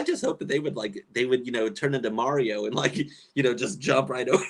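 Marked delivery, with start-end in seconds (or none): none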